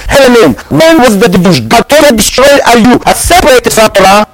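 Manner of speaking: ordinary delivery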